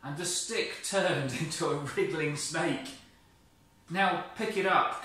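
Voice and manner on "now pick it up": higher, loud command; commanding God. Acted. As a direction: order it briskly. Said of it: "God commanded".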